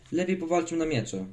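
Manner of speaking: high-pitched eager burst of an idea; delighted exclamation. A narrator's reading. Boy proposes a sword fight to his friend, delighted exclamation. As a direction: exclaim it, pleased with your own idea. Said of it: "wykrzyknął zachwycony swoim pomysłem".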